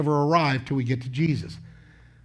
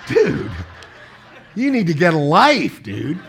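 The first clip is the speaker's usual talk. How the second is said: half-laughing, playful exasperation; laughing mock scold. Father teasing an imagined grown son, laughing mock scold. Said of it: "I'm going".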